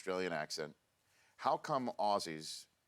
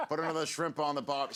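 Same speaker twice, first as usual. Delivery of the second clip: higher, hammed-up Aussie accent; Australian accent; 6 semitones higher, 3 dB louder than usual. Shown as a demonstration. Host mimicking an Aussie for laughs, mock Australian accent. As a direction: throw out a bad Australian catchphrase accent. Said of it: "you do it in an Australian".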